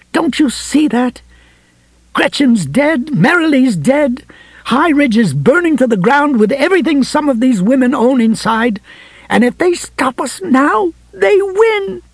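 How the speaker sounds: high, impassioned ferocity climbing at the end; ferocious. A narrator's reading. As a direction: plead furiously, pitch climbing to the last words. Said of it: "Ferocity was the last thing".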